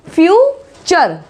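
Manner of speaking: फ्यूचर slowly, FEW-cher with ch; drawn-out फ्यूचर, cher at the end; slow careful pronunciation. Shown as a demonstration. Teacher models the word slowly for class, ch.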